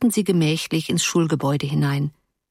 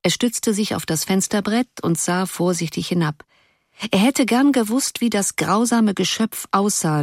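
usual speaking voice

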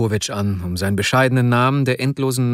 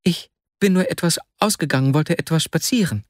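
caught out, dismayed; 7 semitones higher than usual. A narrator's reading. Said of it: "so bestürzt, als hätte man ihn".

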